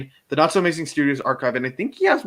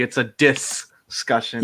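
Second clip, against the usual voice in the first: "discussion" broken after a slow, stressed DIS; slower than usual. Shown as a demonstration. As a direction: stretch DIS, pause, then finish "discussion". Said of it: "It's just insulting me".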